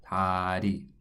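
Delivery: "다리" said the Korean way, flapped R; Korean dari. Shown as a demonstration. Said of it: "It should sound closer to".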